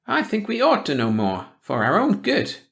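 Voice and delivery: a prying small-town gossip sniffing out trouble; suspicious old gossip. A narrator's reading. Hackett voice.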